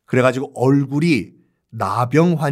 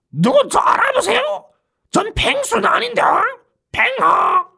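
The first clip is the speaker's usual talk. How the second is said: a wrecked voice after swallowing charcoal; ruined voice. Shown as a demonstration. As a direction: sound like charcoal burned your throat. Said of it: "이런 목소리를 한다".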